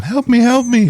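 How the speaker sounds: high, pleading mock cry for help; mock plea. Podcaster mimicking a beaten prop, high mock plea. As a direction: squeal it like a prop begging for mercy. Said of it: "you can see Tomato going".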